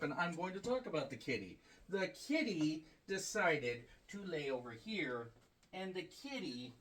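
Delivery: hushed, tender words for the kitty; soft cat talk. Real, not acted. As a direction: coo softly to the cat. Talking softly to the cat.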